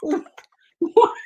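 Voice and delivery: high, loud stunned what; mock disbelief at a strange line; shocked disbelief; baffled. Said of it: "I'm like".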